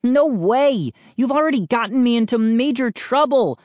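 high, emphatic refusal; indignant cry. A narrator's reading. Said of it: "I cried".

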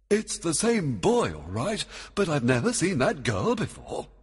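high, emphatic bafflement; puzzled surprise. A narrator's reading. Oberon sees the wrong girl, puzzled. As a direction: stress it as a baffled king.

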